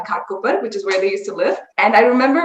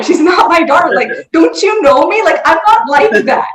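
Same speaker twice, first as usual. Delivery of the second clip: higher-pitched exasperated pleading; retold protest; 6 semitones higher, 3 dB louder than usual. Aunt insisting to her friend it's not her daughter, imitated protest. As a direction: imitate a flustered aunt defending herself.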